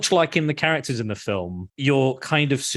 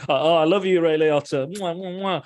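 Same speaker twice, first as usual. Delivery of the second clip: higher-pitched mock adoration with kisses; playful gushing; 6 semitones higher than usual. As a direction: gush like a smitten fan, then smack kisses. Gushing at actor, blowing kisses.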